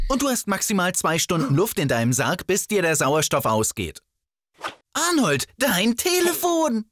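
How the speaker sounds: ordinary delivery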